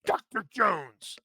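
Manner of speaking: sharp, slow military shout. Acted. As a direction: snap it like an officer. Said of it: "military voice".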